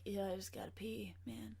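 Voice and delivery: soft whisper as the cat; breathy whisper for a pet. Pretend. Voicing her cat, whispered.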